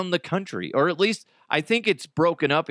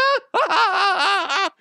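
creepy clown cackle; 5 dB louder than usual. Pretend.

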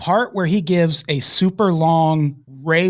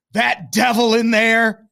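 slow, drawn-out preacher line, a touch higher; preachy pep talk; 6 semitones higher than usual. Retold or imitated. Mimicking the adoptive father's pep talk at the door, slow and preachy. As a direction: hype it like a coach with Jesus talk. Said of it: "he's saying stuff like".

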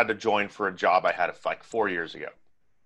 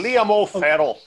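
put-on high, loud Irish accent; 10 semitones higher, 4 dB louder than usual. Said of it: "I can't even do an Irish accent".

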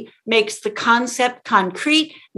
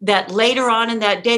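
ordinary talk, nothing marked

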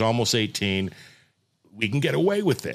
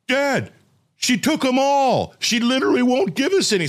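high, louder mock complaining kid; whiny son; 12 semitones higher, 4 dB louder than usual. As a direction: play the upset little boy, pitched up. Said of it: "And he's like".

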